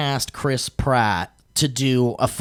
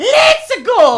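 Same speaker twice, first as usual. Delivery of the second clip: high, loud Mario shout; cheery squeaky plumber call; over-the-top Mario; Mario impression; 10 dB louder than usual. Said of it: "he talks like".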